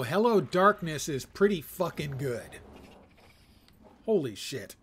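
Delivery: ordinary talk, nothing marked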